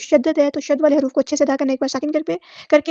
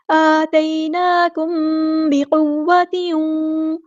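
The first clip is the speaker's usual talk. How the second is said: slow, drawn-out recitation; slow Quranic recitation; much slower than usual. Shown as a demonstration. Teacher reciting verse words slowly for students. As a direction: stretch every vowel as in tajweed.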